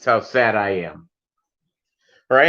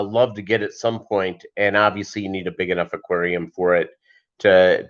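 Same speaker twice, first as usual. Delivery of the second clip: normal talking voice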